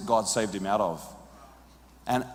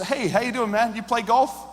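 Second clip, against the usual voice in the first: much higher, breezy hello to a stranger; nervous friendly greeting; 8 semitones higher than usual. Retold or imitated. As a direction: cover the fear with a high cheerful hello. Nervous greeting to man with club.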